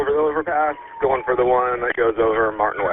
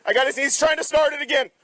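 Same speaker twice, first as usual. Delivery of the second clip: urgent and tense. Real, not acted. Struggling with suspect in truck.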